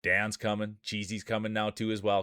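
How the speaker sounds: usual speaking voice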